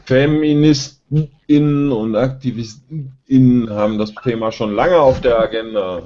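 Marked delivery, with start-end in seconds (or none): 0.0-3.7 FeministInnen und AktivistInnen slowly and emphatically, with a marked gap before each Innen; louder, higher, overstressing the gender pause in FeministInnen and AktivistInnen; betont gegendert. Acted. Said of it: "Das ist sehr schön betont". (FeministInnen und AktivistInnen langsam und überdeutlich betont, mit Pause vor Innen)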